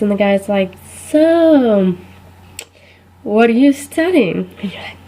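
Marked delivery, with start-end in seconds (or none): 1.1-4.5 awkward date. Retold or imitated. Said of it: "it's kinda awkward silence".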